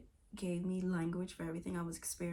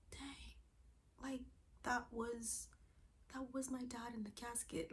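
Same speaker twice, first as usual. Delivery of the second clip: hushed, higher, halting voice; retold stunned realization; 5 semitones higher, 7 dB quieter than usual. Realizing it was her dad, retold hushed shock. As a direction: let it sink in softly, with pauses.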